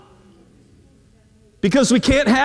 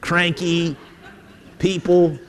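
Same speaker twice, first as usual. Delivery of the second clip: put-on young people sneering; 5 semitones lower, slower than usual. Preacher voicing youth scoffing at old people, in a low mock sneer. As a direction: scoff like a bored teenager. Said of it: "younger generation ... going".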